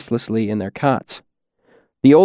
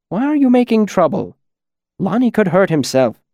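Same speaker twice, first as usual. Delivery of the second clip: mild, higher-pitched reproach; serious, not stern; 7 semitones higher than usual. A narrator's reading. Trappist to Tanny about the trouble, mildly serious. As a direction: chide seriously without sternness. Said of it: "His voice was serious, but not stern".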